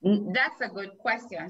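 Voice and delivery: normal talking voice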